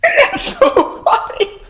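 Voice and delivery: squealing it out through high laughter; can barely speak for laughing; real laughter, slow and high. Real, not acted. Laughing at the British line.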